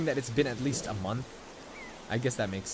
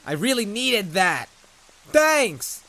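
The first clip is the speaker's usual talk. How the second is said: sarcastic shouted thanks, climbing higher; mock angry thanks; 8 semitones higher, 8 dB louder, slower than usual. Gamer mock-yelling thanks over a useless letter. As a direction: yell your thanks like you're fed up. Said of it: "I'm sorry, I'm not angry, I'm just kidding".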